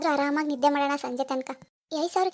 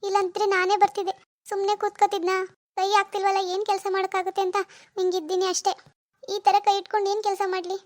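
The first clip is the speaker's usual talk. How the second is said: higher-pitched feigned plaintive whine; put-on helpless pleading, pitched up; playing the sympathy card; 5 semitones higher, 3 dB louder than usual. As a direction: fake a painful injury to win pity. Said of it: "ನನ್ sympathy ಕಾರ್ಡ್ನೇ use ಮಾಡ್ಬೇಕು".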